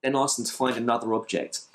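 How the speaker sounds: normal talking voice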